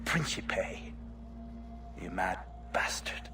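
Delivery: fading out with a blade in him; dying whisper. Acted. Templar dying, whispering final words.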